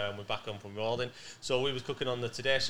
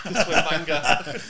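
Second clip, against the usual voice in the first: laughing through the name, louder and slow; 4 semitones higher, 8 dB louder, slower than usual. Real, not acted. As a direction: giggle it out, delighted with the joke. Laughing at their sandwich name.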